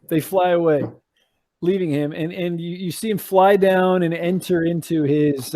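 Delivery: usual speaking voice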